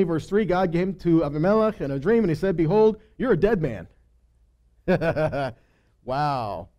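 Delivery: ordinary talk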